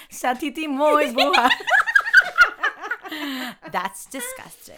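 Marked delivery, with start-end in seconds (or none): 0.1-1.5 high loud quote through laughter; laughing mock quote. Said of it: "She said". 1.5-3.6 high loud burst of laughter. Real, not acted. Loud laugh after joke.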